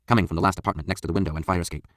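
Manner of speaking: ordinary delivery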